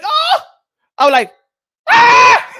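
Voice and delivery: loud, high shocked cries; shocked yells. Retold or imitated. Retelling seeing the tweet, loud high shocked cries. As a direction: scream like you just saw something awful.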